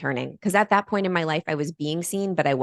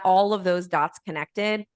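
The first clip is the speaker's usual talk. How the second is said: ordinary talk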